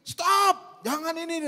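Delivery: high mock yell at children; imitating a shout. Teacher yelling all day at small children, mock shouting. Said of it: "Sepanjang hari dia teriak".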